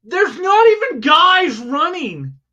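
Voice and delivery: high, loud indignant protest; worked-up yelling. Real, not acted. Arguing with co-host about a music video, worked-up yelling. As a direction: yell it like an outrage, stretching the key words. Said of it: "You don't need to yell".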